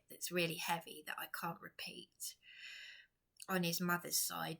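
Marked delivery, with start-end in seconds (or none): 0.1-2.3 whisper. Real, not acted. Confiding a secret about partner.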